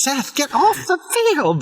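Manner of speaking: high-pitched movie-quote yell; imitated yell. Fan quotes the soccer scene, mock yelling at Seth. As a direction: mimic yelling a player off the field. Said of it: "The way he's yelling at him".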